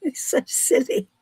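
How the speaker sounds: real laughter around the words; helplessly tickled by a silly joke; giggling. Real, not acted. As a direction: speak between giggles.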